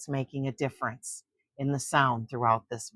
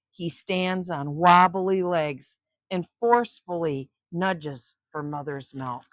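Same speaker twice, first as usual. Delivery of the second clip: put-on expressive read-aloud narration for kids; story reading; 2 semitones higher than usual. A narrator's reading. Grandma reading a nature book to children, storytelling voice. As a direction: read it like grandma at bedtime, with suspense.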